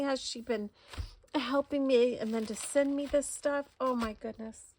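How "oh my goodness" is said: ordinary talk, nothing marked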